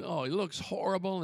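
usual speaking voice

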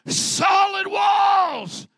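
very loud, high, drawn-out cry; preacher's shout. Acted. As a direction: bellow both words, stretching them long. Preaching climax of Jericho story.

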